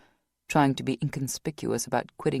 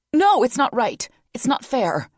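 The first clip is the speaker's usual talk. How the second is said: higher, louder insistent refusal; protesting against the unfairness; distraught protest; 9 semitones higher, 8 dB louder than usual. Acted. Vanessa protesting.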